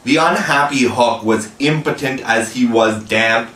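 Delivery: usual speaking voice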